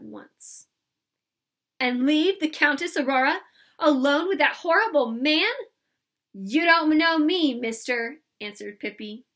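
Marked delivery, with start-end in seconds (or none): 1.8-5.5 loud, higher, outraged question; indignant and raised; indignant protest. Acted. Pippi protests to manager. 6.3-8.2 loud, higher, drawn-out retort; defiant comeback, slowly stressed. Acted. Pippi defies the manager.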